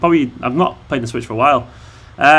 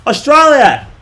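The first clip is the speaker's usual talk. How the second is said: high, loud hammed-up Australian; mock Australian accent; 8 semitones higher, 5 dB louder than usual. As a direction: holler it like a caricature Australian. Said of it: "If you put Australian flag".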